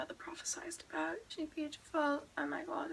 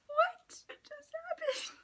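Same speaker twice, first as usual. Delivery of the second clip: laughing disbelief; 6 dB louder, much slower than usual. Real, not acted. Laughing disbelief after quote.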